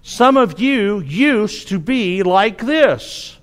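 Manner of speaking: each word slow and deliberate, quoting the verse; slow scripture reading. Shown as a demonstration. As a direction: read the verse slowly, letting it sink in. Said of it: "because of verse eleven".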